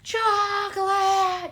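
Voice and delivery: very slow, high cartoon drawl; chocolate seller voice. Streamer quoting the chocolate guy, slow and high. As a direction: stretch the word like a strange salesman.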